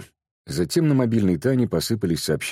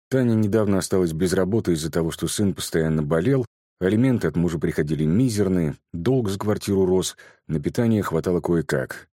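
ordinary delivery

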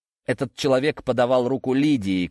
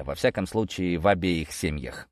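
ordinary delivery

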